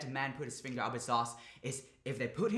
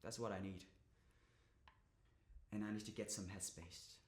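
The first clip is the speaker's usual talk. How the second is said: very soft, low and withdrawn; deflated, near-murmured small talk; resigned confiding; 6 semitones lower, 10 dB quieter than usual. Acted. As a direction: wind down quietly, spent. Resigned confiding to silent listener.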